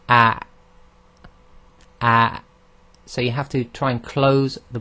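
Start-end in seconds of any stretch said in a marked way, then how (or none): 0.1-2.4 a cut off abruptly at the bottom of the throat; hamza demo. Shown as a demonstration. Teacher demonstrating the hamza to students, sharp and clipped. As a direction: snap the throat shut on a. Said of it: "This is".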